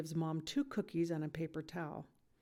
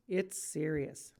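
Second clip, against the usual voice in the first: ordinary delivery, nothing marked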